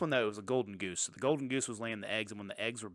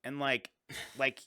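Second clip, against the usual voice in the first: usual speaking voice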